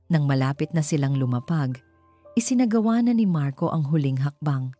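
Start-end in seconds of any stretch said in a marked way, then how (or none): none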